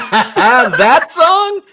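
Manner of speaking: loud, high, laughing outburst; real laughing disbelief. Real, not acted. Co-host stunned by the Shinedown comparison, laughing disbelief. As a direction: burst out laughing through the words.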